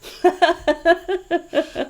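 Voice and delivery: bursting into a high, loud laugh; a big real laugh at the punchline. Real, not acted. Host laughing loudly at the guest's joke.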